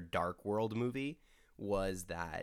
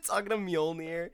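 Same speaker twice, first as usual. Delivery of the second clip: words squeezed through a laugh; 7 semitones higher, 6 dB louder than usual. Real, not acted. Telling a friend the Stormbreaker joke, laughing through the words. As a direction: say it still laughing.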